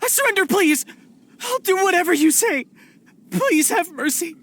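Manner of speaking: high-pitched frantic begging; panicked surrender. Acted. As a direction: throw yourself on her mercy, voice up high.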